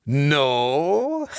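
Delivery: high gloating ghost laugh; mocking chuckle. A narrator's reading. Dead man laughs at Lance's ignorance, mocking. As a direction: chuckle at the helpless boy. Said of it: "Clancy chuckled".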